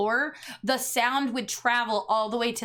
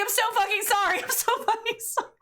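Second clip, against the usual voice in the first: high apologetic; 7 semitones higher, faster than usual. Retold or imitated. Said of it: "And I was like".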